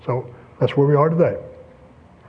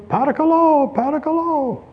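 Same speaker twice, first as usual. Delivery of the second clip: begging cry; 11 semitones higher than usual. Retold or imitated. Preacher imitating a beggar's high pleading call. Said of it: "he's going up".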